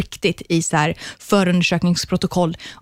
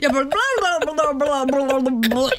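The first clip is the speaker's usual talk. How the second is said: higher, bubbly bla-bla run; hyper babble; 5 semitones higher than usual. Real, not acted. Guest wired on coffee, babbling higher and giddy. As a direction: babble on fast like too much coffee. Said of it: "gud vad mycket kaffe jag har druckit".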